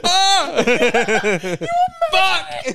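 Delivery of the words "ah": shrill mock shriek, much higher; high scream. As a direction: yelp in fake panic. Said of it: "Just was like".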